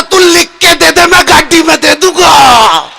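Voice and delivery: acted outburst with a long-drawn ending; exasperated outburst. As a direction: cut in, stretching the last word out. Exasperated man cuts off the advice.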